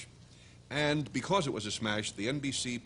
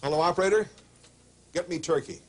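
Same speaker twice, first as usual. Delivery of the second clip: loud, high into the phone; loud phone call; 4 semitones higher, 7 dB louder, faster than usual. Acted. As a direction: call the operator loudly. Calling the operator loudly.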